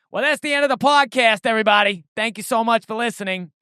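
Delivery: put-on breezy podcast sign-off in a mild Boston accent. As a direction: outro read with a hint of Boston. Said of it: "do the outro in a Boston accent".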